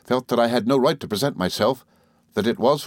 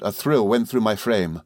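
ordinary delivery, nothing marked

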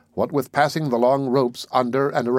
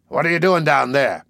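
raised, demanding question; 4 semitones higher, 4 dB louder than usual. A narrator's reading. Captain demanding from above, raised voice. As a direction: press him hard for an answer. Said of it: "he demanded".

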